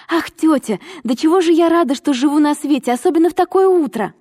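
put-on high, rushing girlish delight; overjoyed. A narrator's reading. Girl running to greet her aunt, overjoyed. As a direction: burst out with sheer joy.

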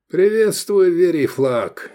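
high, loud hail; hearty greeting. A narrator's reading. Pilot greeting the gladiator school owner, hearty greeting. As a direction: hail him with a high, ringing hello. Hailing an old acquaintance, loud.

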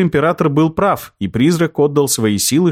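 ordinary delivery

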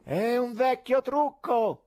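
doubting heckler; heckler from the gallery. A narrator's reading. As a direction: scoff from the back rows. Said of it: "si udì dal loggione".

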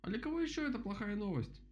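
high-pitched storybook rabbit; voiced rabbit character. A narrator's reading. Rabbit asks for plain words, high rabbit voice.

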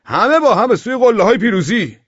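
high, shouted rallying slogan; loud voice. A narrator's reading. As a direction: belt it out like a fervent rallying cry. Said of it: "با صدای بلند گفت". (فریاد شعاری بلند و پرشور)

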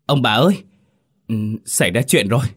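panicked servant calling out to master and mistress. A narrator's reading. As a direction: burst in with frightened news. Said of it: "hốt hoảng vang lên".

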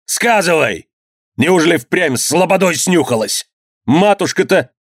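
high-pitched, outraged interrogation; enraged cry. A narrator's reading. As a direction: demand answers from your wife in a rage. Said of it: "вскочил и закричал". (гневный крик, на высоких тонах)

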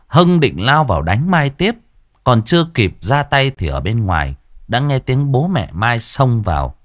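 usual speaking voice

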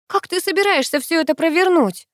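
high-pitched, indignant questioning. A narrator's reading. Sister demands to know the plan, indignant. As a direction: demand it in outrage, pitched well up. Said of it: "продолжала возмущённо шипеть на меня Геля".

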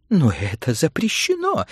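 high, wailing protest; plaintive cry. Acted. Mrs Bowles objects that reading is forbidden, wailing. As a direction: wail in complaint. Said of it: "жалобно возопила миссис Баулс".

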